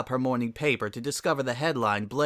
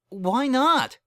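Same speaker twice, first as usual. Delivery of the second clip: high, louder startled why not; shocked question; 13 semitones higher, 4 dB louder than usual. Acted. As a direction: blurt it out in disbelief.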